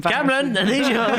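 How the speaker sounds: shrill high teacher voice; high mock teacher. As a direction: squeak it like a nagging teacher. Mock teacher calling kid out.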